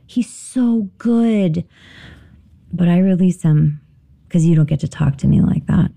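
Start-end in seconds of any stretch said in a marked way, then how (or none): 0.1-1.6 very slowly, higher, aching; tender longing. Real, not acted. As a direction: linger on each word fondly. Heartbroken over a lost partner.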